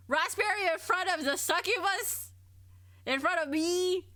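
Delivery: hammed-up indignation at a rude word; mock outrage. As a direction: act shocked, like a scandalised succubus. Mock offense at crude word.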